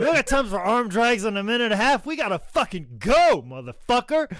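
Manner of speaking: high, fast mock urgency; hammed-up hurry, time is running out; urgent hurrying. Acted wrestler rushing a short match.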